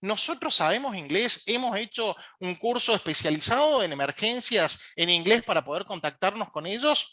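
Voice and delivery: normal talking voice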